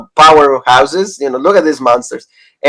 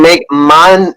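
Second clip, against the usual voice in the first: ordinary talk, nothing marked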